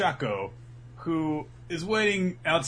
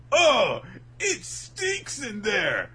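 high, slow mock yell of disgust; hammed-up high shout at the stench; 10 semitones higher, 6 dB louder, much slower than usual. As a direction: gag out the words. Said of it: "he's like".

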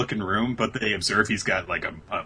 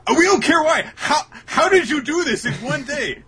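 much higher and louder, astonished demand; shrill excited girls; 7 dB louder than usual. Retold or imitated. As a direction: shriek the question in disbelief. Girls demanding how he did it.

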